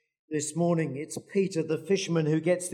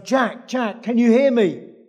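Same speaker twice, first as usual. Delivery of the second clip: higher, louder call from above; a voice shouting down to a man on a ledge; 6 semitones higher, 8 dB louder than usual. Acted. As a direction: call down the cliff to Jack. Voice calling down cliff.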